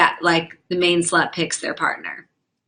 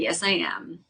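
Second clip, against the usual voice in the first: quiet smug repeat; 7 dB quieter than usual. Real, not acted.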